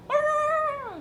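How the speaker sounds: high wailing cry of mourners; imitating a mourning wail; mourner's wail. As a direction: let out a piercing mourning wail. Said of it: "소리 지르고 막 떠들어".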